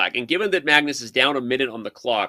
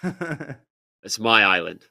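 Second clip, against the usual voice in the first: slow, lower mock Irish accent; failed Irish accent; 5 semitones lower, much slower than usual. Quoting Braveheart to the co-host, poor Irish accent. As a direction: attempt a Braveheart Irishman, badly. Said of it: "can't even do the Irish accent".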